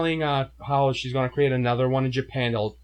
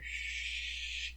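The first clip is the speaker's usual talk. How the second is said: whooshing effect; 16 dB quieter than usual. Retold or imitated. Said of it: "I got some effects in here".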